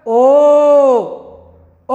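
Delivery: slow drawn-out ओ showing throat and lips; demonstrating the vowel O. Shown as a demonstration. Teacher sounding out ओ for pupils. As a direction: say ओ slowly so the lips round. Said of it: "तो देखिए, ओ पूछा है ना".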